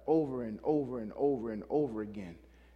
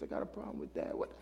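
breathy whisper of a mock complainer; whispered complaint; 14 semitones lower, 10 dB quieter, faster than usual. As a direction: whisper it like a sulking member.

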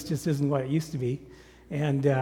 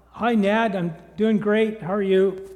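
raised, higher voice thrown across a distance; retold shouted reply to a greeting; calling back; 7 semitones higher, 8 dB louder, slower than usual. Busy man answering his far-off neighbor, calling out.

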